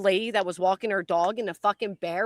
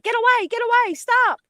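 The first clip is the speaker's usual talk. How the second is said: high, frantic mock shriek, much higher; panicked woman imitation; 14 semitones higher, 5 dB louder than usual. Retelling the lady spinning from the bear, high mock panic. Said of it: "she's, like, spinning in circles, like".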